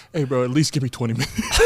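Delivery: low, quiet plea imitating a sleepy friend; hushed, deadpan impression of a groggy bro; dead serious. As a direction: imitate someone seriously bargaining, pitched well down and soft. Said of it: "being dead serious".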